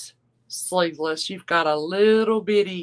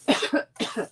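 dry coughing fit; 4 semitones higher than usual. Real, not acted. Host coughing mid-stream, then excusing herself. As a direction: cough as if choked on nothing. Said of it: "Excuse me".

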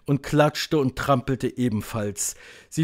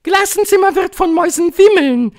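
very high, loud witch voice; piercing high proclamation; witch calling out; 12 dB louder than usual. A narrator's reading. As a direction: shout it as a domineering witch leader. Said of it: "rief die Hoch- und Großmeisterhexe".

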